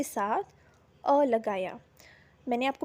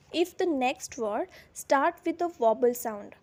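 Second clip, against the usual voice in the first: slow dictation; much slower than usual. Shown as a demonstration. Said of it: "teacher dictating the rule slowly".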